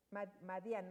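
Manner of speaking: ordinary talk, nothing marked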